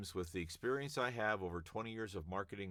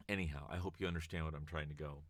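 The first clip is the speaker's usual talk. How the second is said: normal talking voice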